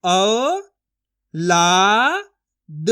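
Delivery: each syllable said separately with pauses; sounding out syllables. Shown as a demonstration. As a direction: model the syllables औ, ला, द one by one for children. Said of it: "मैं किस तरीके से एक ही अक्षर का उच्चारण करके पढ़ रहा हूँ".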